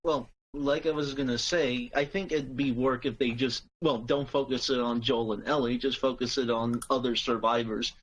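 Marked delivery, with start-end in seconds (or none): none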